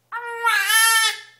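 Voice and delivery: squealing laugh, loud and high; high burst of laughter. Real, not acted. Baby makes parent laugh.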